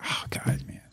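whispered, almost no voice; a near-silent overwhelmed whisper; choked whisper. Retold or imitated. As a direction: breathe it out, choked up by the kid. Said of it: "You're like".

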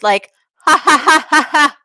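loud hearty ha ha ha from the chest; big chesty ha ha laugh. Shown as a demonstration. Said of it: "You can also laugh through your, your chest".

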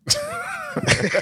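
high, breathless laugh; cracking up at the confusion; burst of laughter. Real, not acted. Host cracks up at a question, bursting laugh.

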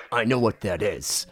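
low, slow rock-star voice; slow, deep-pitched imitation. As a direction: say it low and knowingly. Imitates [name] eyeing the shirt.

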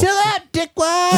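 high, loud squeaky put-on voice; exaggerated high, loud jeer; mock kid taunt. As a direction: blurt it as a silly high-voiced insult. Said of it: "You gotta say things like".

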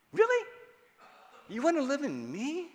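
wide-eyed wonder asking God; amazed disbelief. Pretend. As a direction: marvel at it like you can't believe it.

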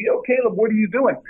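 retold yell. Fan yelling at a player on TV, mildly mock-yelled. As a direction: imitate an exasperated fan calling out to a player. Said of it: "would yell at the T V".

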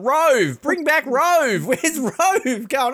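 high, slow mock wail for Rove; hammed-up pleading radio host; Jackie O impression. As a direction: drag it out like a whiny radio host. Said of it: "Jackie O going".

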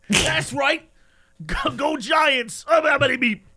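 high, loud New York sports fan; New York guy. Acted. New York guy cheering Giants, hammed-up shout. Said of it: "my New York guy".

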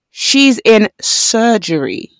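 very slow, each word drawn out; deliberate, stretched-out retold line; slowed right down. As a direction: spell it out slowly for someone not listening. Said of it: "he has to slow it down".

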